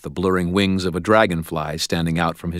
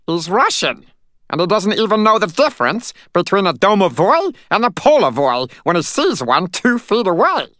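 high, loud outraged squeak; shrill furious; 14 semitones higher, 5 dB louder than usual. Acted. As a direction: shriek it indignantly. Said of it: "quivered with outrage".

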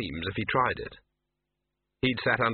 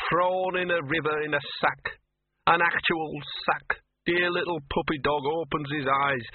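put-on higher, tearful drunken lament; Gaspode, self-pitying drunk dog; 5 semitones higher than usual. A narrator's reading. Gaspode telling Laddie his hard start, maudlin. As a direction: pour out maudlin self-pity.

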